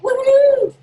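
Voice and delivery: a high, loud whoop of delight; excited woohoo. Retold or imitated. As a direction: cheer it out like you just won. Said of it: "I was like".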